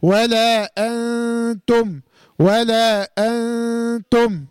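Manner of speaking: ولا أنتم very slowly, the ن of أنتم hidden in a long nasal hum; nasal ikhfa. Shown as a demonstration. Teacher shows the hidden nun with ghunna. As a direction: hum the ن in أنتم through the nose, tongue tip off the gum. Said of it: "فبقولها بقى إزاي".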